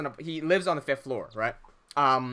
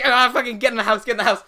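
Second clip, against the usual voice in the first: higher, louder mock panic; raised-voice scramble to escape; urgent retold yell; 4 semitones higher, 7 dB louder, faster than usual. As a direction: act out hustling friends indoors in a hurry. Retold panic fleeing drunk lady.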